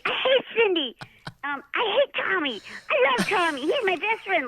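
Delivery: high little girl voice, lightly mocking; young daughter voice. Mother voices her young daughter.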